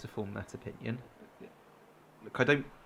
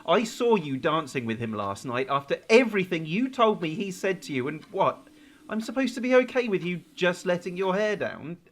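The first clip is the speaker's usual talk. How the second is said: loud, high, bitter confrontation; angry accusation; 7 semitones higher, 9 dB louder than usual. Acted. Man accusing his girlfriend Scarlett of dancing with another, angry. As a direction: hurl the accusations at her.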